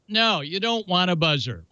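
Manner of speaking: ordinary delivery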